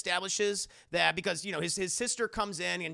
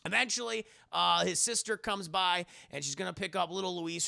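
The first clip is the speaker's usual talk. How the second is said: usual speaking voice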